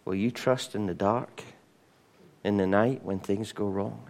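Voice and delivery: ordinary talk, nothing marked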